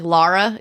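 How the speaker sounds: Laura with an open "ah", "LAH-ra"; mom's Philly pronunciation. Retold or imitated. Imitating what mom will say, Laura as "LAH-ra". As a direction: say Laura as "LAR-a". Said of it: "she'll be like".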